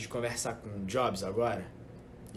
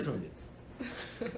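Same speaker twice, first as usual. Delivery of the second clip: whispered; 9 dB quieter, much slower than usual. Pretend. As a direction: whisper the name. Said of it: "me escuta".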